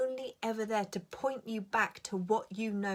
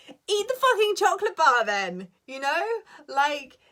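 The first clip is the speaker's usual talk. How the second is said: higher, louder, exasperated; exasperated outburst; 8 semitones higher, 11 dB louder than usual. Real, not acted. Telling viewers to just eat the chocolate, exasperated and loud. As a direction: blurt it out, fed up with dithering. Venting frustration at own indecision.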